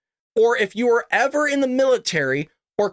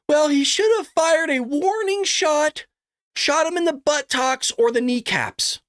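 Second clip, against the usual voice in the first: mocking voice; 9 semitones higher, slower than usual. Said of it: "This knucklehead goes".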